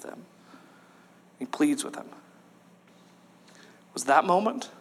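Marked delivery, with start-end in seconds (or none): none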